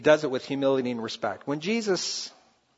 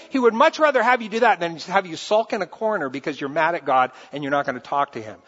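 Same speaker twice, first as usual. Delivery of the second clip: ordinary talk, nothing marked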